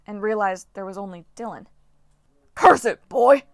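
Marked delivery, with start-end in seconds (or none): 2.5-3.4 loud, high, irritated bark; snapping coach. Acted. Coach Hedge snapping at Jason for leaving no monsters. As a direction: snap it at the boy. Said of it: "he snapped at Jason".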